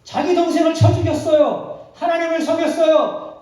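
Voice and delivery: high, loud, emphatic preaching; preaching at full force. Real, not acted. Preacher stressing Cain's sins to the congregation, loud and high. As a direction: drive home the guilt.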